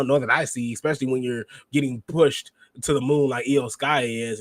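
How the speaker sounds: ordinary talk, nothing marked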